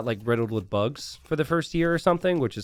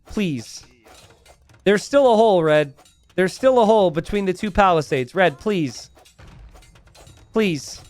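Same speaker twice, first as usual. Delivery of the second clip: higher, louder live commentary, urging the players on; excited play-by-play; 4 semitones higher, 4 dB louder than usual. Acted. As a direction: call the game as it unfolds, pleading with Red.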